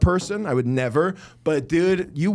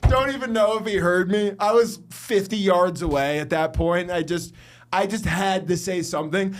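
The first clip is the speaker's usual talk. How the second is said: normal talking voice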